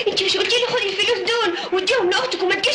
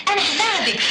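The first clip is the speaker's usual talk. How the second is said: ordinary delivery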